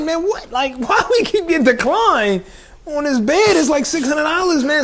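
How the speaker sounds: high, disbelieving rant; incredulous. Retold or imitated. Friend retelling being declined, high incredulous voice. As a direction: voice jumps up in disbelief.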